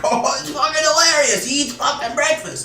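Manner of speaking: high silly alien voice; mock alien laughing. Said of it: "They're like".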